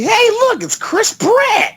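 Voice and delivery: high, slow gushing exclaim; excited squeal. Retold or imitated. Mock character spotting Chris Pratt.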